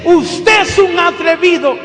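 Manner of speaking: a woman in a wheelchair yelling at the preacher, slow and loud; retold yell. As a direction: shout the insult back at him. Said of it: "ella me grita".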